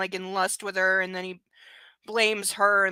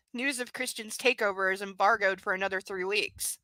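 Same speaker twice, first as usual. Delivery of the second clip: ordinary delivery, nothing marked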